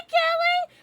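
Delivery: high squeal of a name; thrilled high-pitched hello; squealed greeting. Real, not acted. Greeting a chatter.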